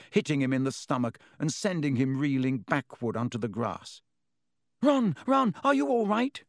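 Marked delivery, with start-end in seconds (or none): 4.8-6.4 a much higher, louder squeal of alarm. A narrator's reading. Hermione squealing in alarm as Ron falls. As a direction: squeak out in panic for your friend. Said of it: "squealed Hermione".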